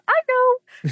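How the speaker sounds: high, loud, gleeful retort; imitating chirpy friend. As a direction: pipe up like an unbothered friend.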